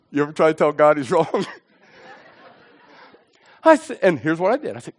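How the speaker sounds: normal talking voice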